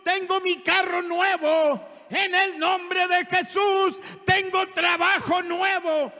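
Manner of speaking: high chant-like proclamation on one note; loud declaration. Shown as a demonstration. Preacher showing congregation what to declare each morning, loud proclamation. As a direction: declare it boldly, each phrase sustained. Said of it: "Usted tiene que declarar en la mañana lo que usted quiere".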